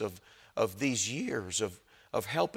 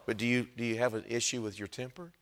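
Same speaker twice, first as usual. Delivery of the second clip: ordinary talk, nothing marked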